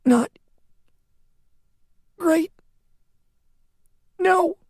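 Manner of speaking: strained, high, barely voiced old man; dying man answering her, straining hard; with great effort. A narrator's reading. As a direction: squeeze out each word. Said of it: "With great effort".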